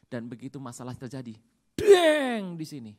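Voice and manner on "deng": loud sudden bang sound; a sharp loud clang to mark the blow; deng. Acted. Preacher to congregation, a loud mock clang.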